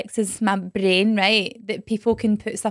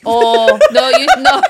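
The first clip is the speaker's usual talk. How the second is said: shocked no; 9 dB louder, slower than usual. Real, not acted. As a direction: protest in shock. Shocked protest.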